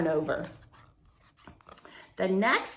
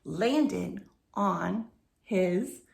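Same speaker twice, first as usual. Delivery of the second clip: usual speaking voice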